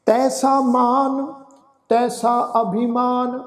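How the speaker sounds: slow, measured sacred verse; scripture recitation. Shown as a demonstration. Teacher reciting Gurbani for the congregation, slow recitation. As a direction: recite slowly, drawing out the long vowels.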